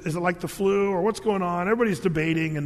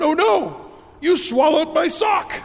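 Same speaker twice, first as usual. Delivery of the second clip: higher, louder, slow horrified cry; horrified punchline; 9 semitones higher, 7 dB louder, slower than usual. Acted. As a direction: deliver the punchline in mock horror.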